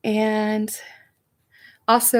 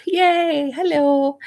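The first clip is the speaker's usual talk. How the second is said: high, loud cheer of welcome; excited greeting; 8 semitones higher, 5 dB louder, much slower than usual. Real, not acted. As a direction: greet a fan with a raised, delighted whoop. Cheering a greeting from Liverpool viewer.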